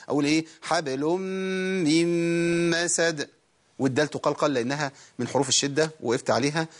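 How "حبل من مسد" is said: very slow held nasals through "من مسد"; double ghunna. Shown as a demonstration. Teacher modelling two doubled meems, drawn-out hummed "حبل من مسد". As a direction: recite "حبل من مسد" with a bounced ب, then two long level hummed meems. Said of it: "ميمين مشددين ورا بعض".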